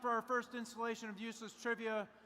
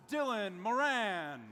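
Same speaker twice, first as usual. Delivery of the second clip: announcer intro; 4 dB louder, slower than usual. Acted.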